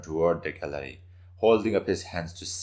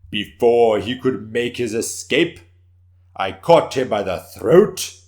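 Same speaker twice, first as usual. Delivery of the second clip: boastful warrior; 5 semitones higher, 12 dB louder than usual. Acted. Warrior boasting of his fight.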